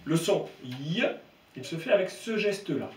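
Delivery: usual speaking voice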